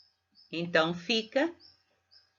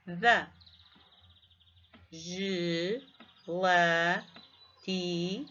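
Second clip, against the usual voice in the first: isolated syllables with long pauses between them; divisão silábica; much slower than usual. Shown as a demonstration. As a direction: sound out each word slowly, syllable by syllable. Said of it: "dividi-las em sílabas para percebermos". (ditado silábico, muito pausado)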